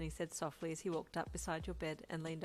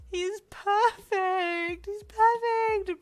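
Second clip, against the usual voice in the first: very high, loud, slow tearful wail; 15 dB louder, much slower than usual. A narrator's reading. Uncle sobbing over the perfect baby, high wail. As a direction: wail it through happy tears. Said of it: "Brian wailed".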